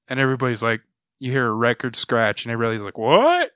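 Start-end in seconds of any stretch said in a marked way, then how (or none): 3.0-3.5 much higher, louder startled what; a stunned squawk of disbelief; shocked what. Retold or imitated. The family stunned when the dad calls the plan dumb, shocked. Everybody shocked after record scratch.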